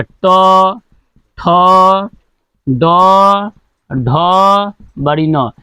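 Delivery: each letter set apart with pauses; slow spaced recitation. Shown as a demonstration. Teacher models the consonant row for pupils, slow and spaced. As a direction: recite letters one by one, slowly. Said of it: "इसका उच्चारण बोल के देखो".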